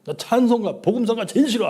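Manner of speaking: rising to a high, loud whine; petulant kid shouting he hates hymns; whiny teenage son. Retold or imitated. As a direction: mimic the son's loud protest. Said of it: "아주 막 이러던 놈인데".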